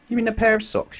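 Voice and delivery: high, amused question; smiling shop lady. A narrator's reading. Shop lady correcting Mister Topsy-Turvy, high and amused. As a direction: gently correct him. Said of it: "she smiled".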